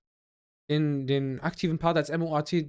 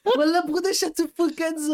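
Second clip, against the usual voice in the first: excited; 11 semitones higher than usual. Real, not acted. Excited after singing.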